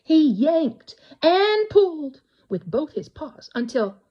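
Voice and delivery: usual speaking voice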